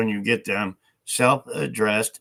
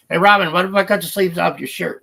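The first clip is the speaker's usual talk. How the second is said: higher, louder call across the room; calling out to Robin; 9 semitones higher, 8 dB louder, faster than usual. Real, not acted.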